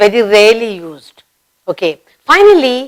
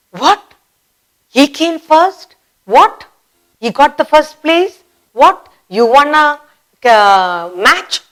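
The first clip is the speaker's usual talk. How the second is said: higher, disbelieving questions; shocked surprise; 6 semitones higher than usual. Shown as a demonstration. Teacher acting out shock at a surprise win. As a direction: sound stunned by the news. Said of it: "you're shocked, surprised".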